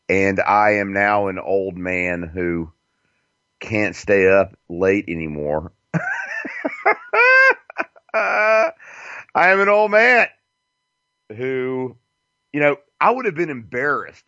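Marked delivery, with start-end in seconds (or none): 5.9-7.8 sudden high-pitched cackle; squeaky self-mocking laugh. Real, not acted. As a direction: burst out in a high giggle. Laughs at being an old man.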